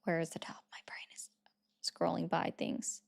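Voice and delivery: ordinary talk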